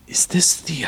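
whispered behind his hands; hushed, conspiratorial whisper; secret whisper. Acted. Tobin hands to lips, secretive.

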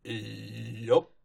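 low, laconic farmhand yup; Big Mac voice. Retold or imitated. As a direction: drop it low and deadpan.

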